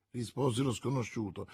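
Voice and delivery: ordinary delivery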